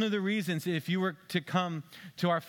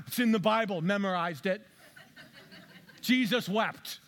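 laughing; 5 semitones higher than usual. Real, not acted. Laughing through mock boast.

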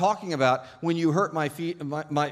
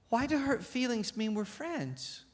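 put-on high, slow puzzled child's voice; small high-pitched child questioning; little girl; 9 semitones higher, 4 dB quieter, slower than usual. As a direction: ask it like a confused small girl. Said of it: "little girl".

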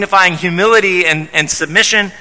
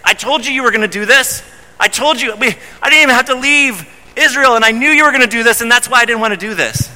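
higher, quickening told-you-so rant; indignant complaint; 6 semitones higher, faster than usual. Retold or imitated. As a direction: throw it back at God, rushing the words. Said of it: "throwing that back to the Lord".

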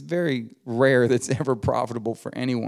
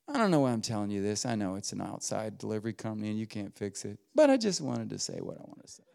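deflated aside; 3 semitones lower, 7 dB quieter, faster than usual. Retold or imitated. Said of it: "she took a deep breath and went".

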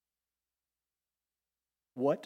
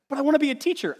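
put-on much higher, louder childish whine; mock whine; 12 semitones higher, 6 dB louder, faster than usual. Preacher voicing a gift-test taker, high mock whine. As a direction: plead like a kid wanting a role.

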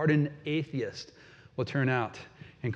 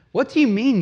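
higher and louder, incredulous demand; a captain scolding a sleeping passenger, incredulous; indignant ship captain; 10 semitones higher, 8 dB louder than usual. Acted. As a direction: rouse him like an angry captain. Captain confronting sleeping Jonah.

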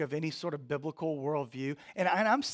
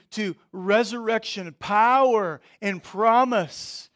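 louder, higher, driving sermon voice; impassioned preaching; 6 semitones higher, 8 dB louder than usual. Real, not acted. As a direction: preach it with force, punching key words.

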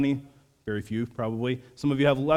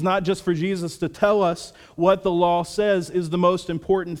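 fervent sermon delivery, raised and pressing; preaching; 5 semitones higher, 3 dB louder than usual. Acted. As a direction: preach it out to the congregation with conviction.

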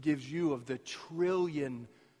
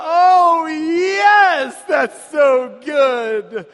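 loud, high, slow relieved shout; relieved cheer; 22 dB louder, much slower than usual. Retold or imitated. Family relieved by the compliment, loud cheer. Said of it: "And we all went".

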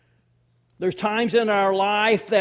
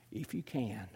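soft reply; 10 semitones lower, 14 dB quieter than usual. Acted. Jesus's reply spoken softly.

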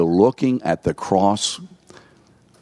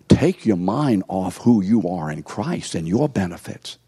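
ordinary delivery

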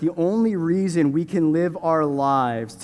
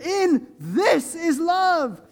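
higher, slow, rising proclamation; preaching at full force; 8 semitones higher, slower than usual. Real, not acted. Pastor preaching First John to the congregation, high and fervent. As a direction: preach it with swelling conviction.

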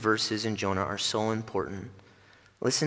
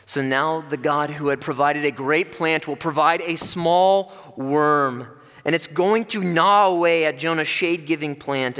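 higher and louder, driving; fervent preaching; 6 semitones higher, 6 dB louder than usual. Acted. Preacher warns of the scorching wind, loud and driving. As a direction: press the warning home.

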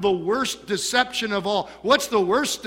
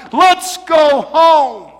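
slow loud plea; 9 semitones higher, 11 dB louder, much slower than usual. Acted. Jesus calling the lost son home, slow loud plea. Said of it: "And he's saying".

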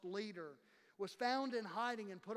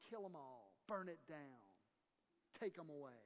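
soft, low, haunted retelling; grim hushed memory of the enemy's cry; hushed echo of the cry; 7 semitones lower, 10 dB quieter than usual. Acted. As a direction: let the war cry fade almost to nothing. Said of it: "somebody else that's crying out".

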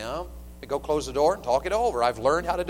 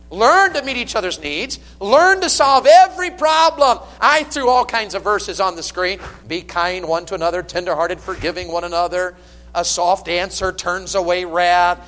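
loud, high, ringing sermon delivery; full-force preaching; 4 semitones higher, 9 dB louder than usual. Acted. Pastor exhorting married couples, loud and high. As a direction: preach it out to the whole church.

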